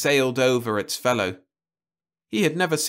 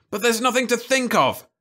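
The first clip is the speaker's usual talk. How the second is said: much higher, louder angry outcry; angry shout; 12 semitones higher, 3 dB louder than usual. A narrator's reading. Jim shouting angrily at Louise. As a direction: shout her down in anger. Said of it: "he shouted angrily".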